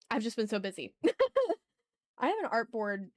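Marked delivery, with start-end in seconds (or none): 1.0-1.5 sudden high squeal of laughter; high burst of laughter. Real, not acted. Laughing about being busy.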